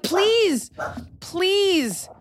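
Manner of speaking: high, pleading whine; mock begging. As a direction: beg like a needy child. Begging to give advice.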